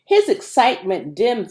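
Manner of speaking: ordinary delivery, nothing marked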